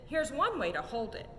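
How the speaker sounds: plain reading voice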